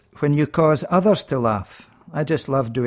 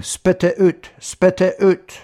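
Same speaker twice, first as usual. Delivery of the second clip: higher and louder, slowing on the repeat, urgent; comic tight-fisted Scot pleading with a fly; stingy Scotsman's mock demand; 6 semitones higher, 4 dB louder, slower than usual. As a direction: order the fly to give back every drop. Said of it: "He's taking the fly out and saying".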